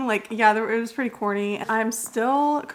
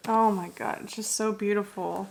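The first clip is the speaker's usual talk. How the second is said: ordinary talk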